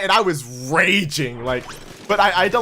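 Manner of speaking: ordinary talk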